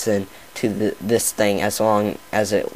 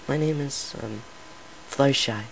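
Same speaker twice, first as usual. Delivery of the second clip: timid mumble; 4 semitones higher, 7 dB quieter than usual. Acted. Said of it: "Say it properly this time".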